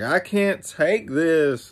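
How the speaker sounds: high, drawn-out complaint; mock exasperation. Companion giving up on keeping pace, mock exasperated. Overwhelmed companion in retold story.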